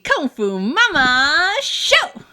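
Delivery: slow, bright host announcement; show opening. Acted.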